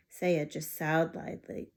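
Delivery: usual speaking voice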